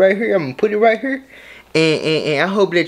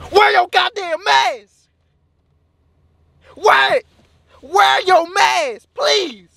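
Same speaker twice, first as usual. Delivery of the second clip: high, drawn-out wailing plea; exasperated pleading; 9 semitones higher, slower than usual. Acted. Vlogger begging people to wear masks, wailing plea. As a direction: beg them in a high-pitched cry.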